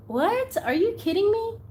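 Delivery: high-pitched incredulous questions; real disbelief. Real, not acted. Collector stunned by a gifted doll, high incredulous reaction.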